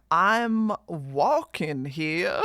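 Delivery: slow, drawn-out mock American street accent; imitating Azza. Streamer recalling a friend's famous line, hammed-up imitation. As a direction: stretch every word in a hammed-up imitation. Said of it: "Flashback to Azza saying".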